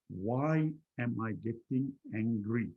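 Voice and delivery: slow, word-by-word question; writing it down. Acted. Speaker writing down their anger, slow deliberate self-questioning. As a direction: spell out the question as if penning it. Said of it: "I'll write it down".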